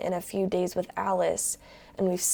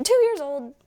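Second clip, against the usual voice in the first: high, loud burst of disbelief; shocked echo of what was just read; incredulous; 6 semitones higher, 6 dB louder than usual. Real, not acted. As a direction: blurt it out, astonished. Shocked at the child's age.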